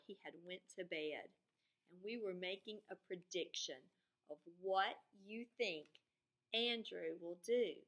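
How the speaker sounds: ordinary talk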